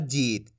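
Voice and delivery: "जीत" with a long, drawn-out ee; long vowel. Shown as a demonstration. Teacher showing what the long matra sounds like, stretched "जीत". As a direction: hold the ee in "जीत". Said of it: "बड़ी ई की मात्रा का उच्चारण".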